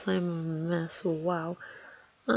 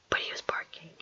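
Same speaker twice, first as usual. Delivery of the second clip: soft whispered aside; 2 semitones lower, 9 dB quieter than usual. Real, not acted. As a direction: whisper it under your breath. Aside about barking dog.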